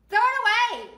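high-pitched heckle from a passenger; acted shouted demand; irritated yell. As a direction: call it out in disgust. Passenger yells about smelly food.

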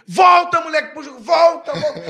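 loud, high-pitched terrace yell; drawn-out cheering call, acted out; imitated crowd shout. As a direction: shout the player back into the game. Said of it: "a galera começa a gritar o nome".

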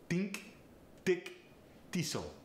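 Think, thick, thistle said tink, tick, tistle; Farsi accent. Shown as a demonstration. Coach demonstrating a Farsi accent, tink, tick, tistle. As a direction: Farsi accent, a plain T for the voiceless th. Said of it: "The voiceless T H gets replaced with a T".

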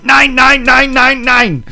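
high, loud mock German rant; ranting Hitler. Hitler pounding the table in a film, loud mock rant. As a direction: pound out the nines like a ranting dictator. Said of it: "Hitler ... pounding on the table going".

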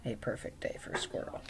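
a perfect day for Squirrel breathed as a soft secret; whisper. Shown as a demonstration. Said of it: "Emphasis on it was".

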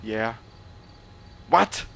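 ordinary delivery

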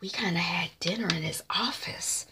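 hushed, secretive whisper; conspiratorial whisper. Retold or imitated. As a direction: whisper a juicy confession. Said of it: "And then she whispers".

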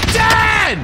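high taunting yell. Real, not acted. Kill taunt.

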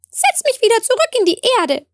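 a tiny blade of grass asking to be put back; talking grass blade. A narrator's reading. Grass blade asks the girl to replant it, high and quick. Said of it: "sagte da der Grashalm".

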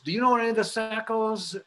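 high, fast mock yell out a window; retold yelling. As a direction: call it up the street like a neighbour. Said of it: "they all started yelling out the windows".

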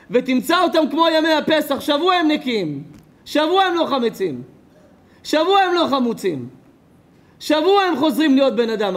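rising sermon refrain; preaching at full force. Acted. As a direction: hammer the refrain at the congregation. Preacher repeats "a week" refrain.

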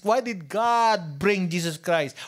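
ordinary delivery

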